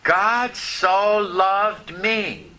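God so loved me, each word drawn out very slowly; preacher showing the congregation how to say it, very slow; slow demonstration. Shown as a demonstration. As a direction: model the words for the congregation to repeat. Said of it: "Try it on".

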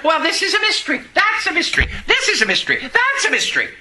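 lightly mocking the elders' brush-off; mock stock answer. Retelling people's stock answers.

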